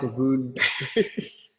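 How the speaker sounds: breaking into giggles on the words; can't get it out for laughing; real laughing. Real, not acted. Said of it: "Sorry, I'm laughing".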